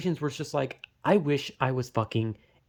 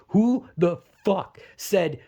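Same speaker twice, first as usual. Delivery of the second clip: slow, higher, loaded indignant question; exasperated outrage; 5 semitones higher, 4 dB louder, slower than usual. Real, not acted. Gamer demanding who approved the mission, exasperated. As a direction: stretch out each word in disbelief. Ranting about whoever designed the mission.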